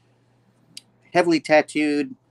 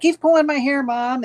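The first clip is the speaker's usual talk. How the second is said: put-on very high, louder kid's whine; tattling little girl; 5 dB louder than usual. Girl tells on her brother to mom in a very high whine. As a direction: complain to mom like a small child.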